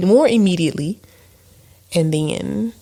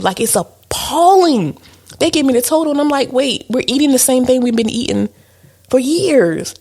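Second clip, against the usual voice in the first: indignant; 8 semitones higher, 3 dB louder than usual. Real, not acted. Complaining about food prices.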